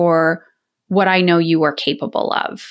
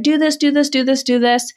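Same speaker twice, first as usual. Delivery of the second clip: higher-pitched, rapid-fire nagging without real volume; mock nagging yell; 5 semitones higher than usual. Former yeller to the kids in the house, mock yelling. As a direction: imitate yourself yelling repeated orders at the kids. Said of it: "yelling constantly in the house".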